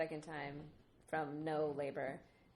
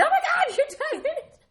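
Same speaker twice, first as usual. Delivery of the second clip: much higher and louder, laughing disbelief; mock shocked nurse; 17 dB louder than usual. Nurse finding the baby already there, laughing shock. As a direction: squeal it like a stunned nurse.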